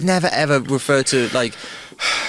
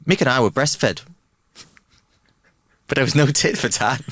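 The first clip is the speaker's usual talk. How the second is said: lightly put-on Irish accent. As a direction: tell it with a joking Irish lilt. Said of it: "I like the Irish accent".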